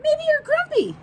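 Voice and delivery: squeaky high suggestion from Norman; high chirpy sidekick voice; high little friend voice. Acted. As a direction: pitch way up for the small bird. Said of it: "suggested Norman".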